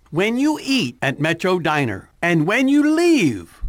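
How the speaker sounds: loud, slow, punchy ad read; radio ad read. Acted. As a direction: sell it like a radio spot. Reads a radio ad.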